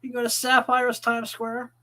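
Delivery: ordinary talk, nothing marked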